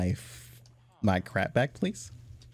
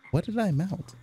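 ordinary talk, nothing marked

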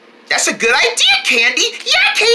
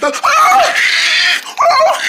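alarmed cry; 13 semitones higher, slower than usual. Real, not acted. Chasing dog.

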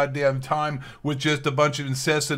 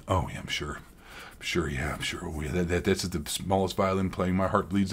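sarcastic; 8 semitones lower, 7 dB quieter than usual. Acted. Sarcastic reply to corporate statement.

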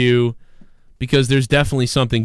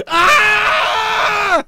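high, loud yell like the man in the clip; a sudden high shouted "Ah!"; imitated crazy yell; 4 dB louder than usual. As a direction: shriek along with the video. Mimics the guy while the clip plays.

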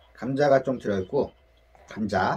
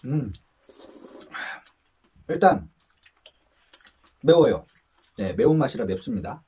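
chewing between words; talking around food; mouth full; 2 semitones lower than usual. Real, not acted. Seen 0: Man eats just before, cheeks full, chews between words, mouth working.

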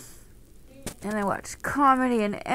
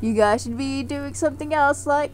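bee voice; 3 semitones higher, 7 dB louder than usual. Pretend. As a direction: pop in as a cheeky bee scolding the viewers. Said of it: "I'm a bee!".

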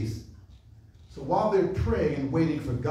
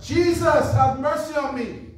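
loud, higher cry for mercy; calling out; 8 semitones higher, 6 dB louder than usual. Acted. Blind beggar calling to Jesus, loud higher cry. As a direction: call to someone passing by. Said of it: "he calls out".

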